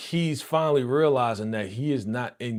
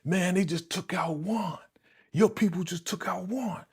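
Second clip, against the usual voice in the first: high-pitched, agitated back-and-forth; heated retold accusation; acted indignant quarrel; 8 semitones higher, faster than usual. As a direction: imitate someone arguing in disbelief.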